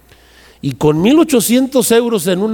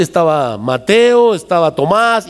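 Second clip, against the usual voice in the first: ordinary delivery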